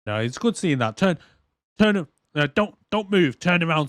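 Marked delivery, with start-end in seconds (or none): none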